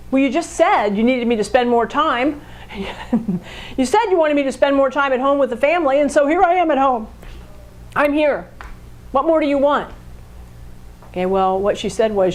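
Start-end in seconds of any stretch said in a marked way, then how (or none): 0.1-9.9 a hint of a defensive husband; mildly voiced husband defending himself; defensive fact person. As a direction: lightly play the husband who throws her words back. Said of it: "I'm gonna put that back at you".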